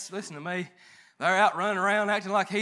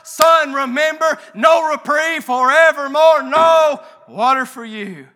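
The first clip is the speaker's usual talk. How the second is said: louder, slow, final judgment from Abraham; stern shouted verdict; 5 semitones higher, 11 dB louder, slower than usual. Retold or imitated. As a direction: thunder the sentence down at the rich man. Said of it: "the answer that came back to him".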